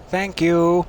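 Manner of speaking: loud thanks. Real, not acted. Thanking the game loudly.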